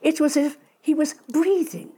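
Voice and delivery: ordinary delivery, nothing marked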